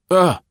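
sudden startled yelp, high and loud; involuntary shout. A narrator's reading. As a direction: blurt a shocked grunt as if bumped in the dark. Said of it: "Matt gave an involuntary shout".

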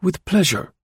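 high, smooth, unbothered voice; bland reply. A narrator's reading. Chauvelin calmly answering Gourdon's bluster. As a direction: answer blandly as if nothing matters. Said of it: "replied Chauvelin blandly".

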